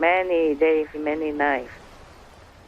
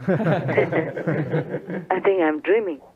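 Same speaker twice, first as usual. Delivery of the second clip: amused, through chuckles; storyteller breaking into laughter on a quote; laughing; 3 semitones lower, much slower than usual. Acted. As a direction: voice the king's thought while laughing. Storyteller laughs voicing the bewildered king.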